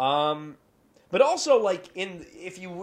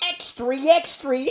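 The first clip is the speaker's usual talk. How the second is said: high, slow, loud newsboy call; newsboy crier; 11 semitones higher, 7 dB louder, slower than usual. Retold or imitated. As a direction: cry it like a paperboy. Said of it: "I hear you as, like".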